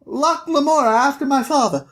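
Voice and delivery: put-on high little-boy voice; small boy piping out his full name; Locke. Locke tells the Thiefmaker his name, piping boy voice. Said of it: "And he's like".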